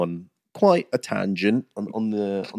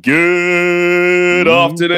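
long, high, loud drawn-out greeting; calling out a greeting; 7 semitones higher, 6 dB louder, much slower than usual. Pretend. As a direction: stretch every vowel like a show opener. Calling out the show's opening greeting.

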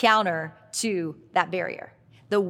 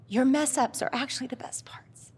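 whisper; 5 semitones higher than usual. Real, not acted. Passing advice to her younger self, in a whisper. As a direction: whisper it like a confidence.

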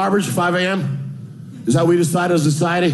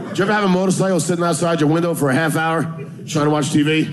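normal talking voice

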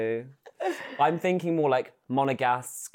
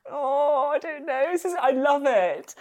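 much higher, flustered delight; playful fluster; 13 semitones higher than usual. Real, not acted. Asked to describe herself in three words, playfully flustered. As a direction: squirm happily in a high pitch.

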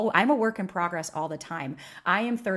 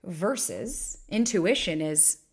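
normal talking voice

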